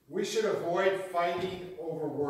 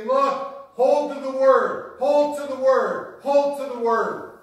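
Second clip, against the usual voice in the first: loud, urgent repeated command; loud, insistent preacher's charge; forceful charge; 5 semitones higher, 8 dB louder than usual. Acted. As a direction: drive it home like a preacher. Preacher voicing Paul's charge.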